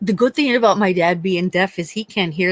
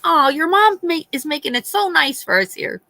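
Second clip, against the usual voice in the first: put-on higher, sing-song sweet girlfriend; 7 semitones higher than usual. Mom imitating son's date praising her, higher and sweet. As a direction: chirp it like a sweet, cheerful guest. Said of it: "She went".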